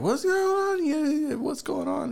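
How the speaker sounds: very high-pitched mock panic; frantic. Friend runs in to calm his raging grandma, frantic. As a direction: squeal it as a hammed-up fluster.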